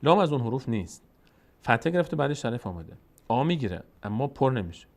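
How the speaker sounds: ordinary delivery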